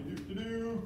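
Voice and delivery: usual speaking voice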